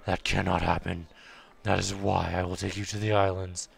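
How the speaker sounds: low, brooding boatman read; Batman. Acted. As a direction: drop the pitch and intone it darkly. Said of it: "Now I've gone Batman".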